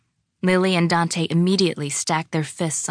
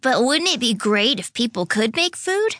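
put-on high, delighted little-boy voice; grinning excitement; 7 semitones higher than usual. A narrator's reading. Dante imagines people making food, grinning and excited. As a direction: say it through a wide grin. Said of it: "grinning widely".

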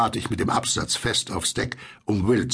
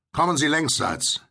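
higher, raised call; 8 semitones higher than usual. A narrator's reading. Will invites the boat alongside, calling out. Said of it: "rief Will".

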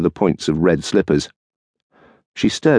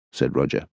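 ordinary delivery

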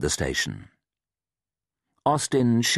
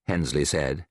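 ordinary talk

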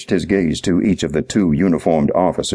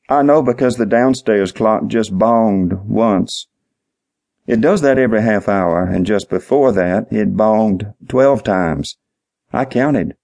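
higher-pitched, simple-minded witness, earnestly explaining; dim-witted night manager; 4 semitones higher than usual. Acted. Clerk explains the clock to the detective, dim-witted and earnest. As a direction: draw out the key words like a slow-witted clerk.

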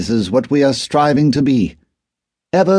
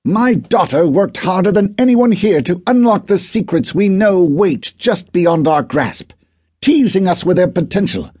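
much higher, louder enraged outburst; furious shout; 12 semitones higher, 3 dB louder than usual. Acted. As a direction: whisper yelling rising to a furious cry. Arthur's furious retort.

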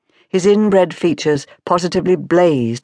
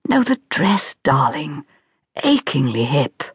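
lightly voiced society gossip; a hint of hushed fashion snobbery; gossiping guest. A narrator's reading. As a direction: murmur it knowingly to a friend. Gossiping women whisper in church.

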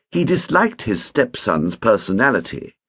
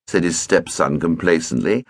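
normal talking voice

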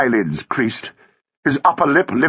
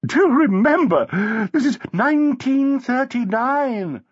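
high, slow, pointed reminder; lilting, high-pitched society woman; airy wife; 13 semitones higher, slower than usual. A narrator's reading. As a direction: chide him loftily, drawing it out.

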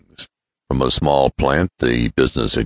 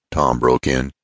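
ordinary delivery, nothing marked

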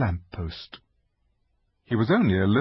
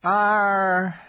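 melancholy sigh; 10 semitones higher, 4 dB louder than usual. Acted. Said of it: "in a rather melancholy voice".

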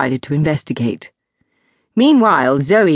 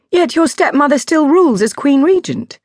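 high, louder surprised objection; Zoe pointed; 10 semitones higher, 4 dB louder than usual. Acted. Zoe questioning the princess about the regent, higher and louder. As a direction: push back, puzzled and pointed.

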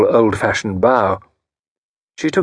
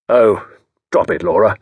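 usual speaking voice

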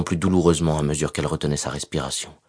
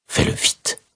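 whispered; slower than usual. Acted. Whispered plea, knife at throat.

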